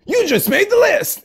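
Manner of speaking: very high, slow shrieked taunt; hammed-up Jericho catchphrase; Jericho impression. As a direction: shriek it like a wrestling heel.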